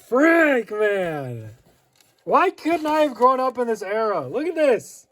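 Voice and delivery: high, loud, wistful exclaiming; wishing he grew up then; real excitement; excited longing. Real, not acted. Catalog nostalgia.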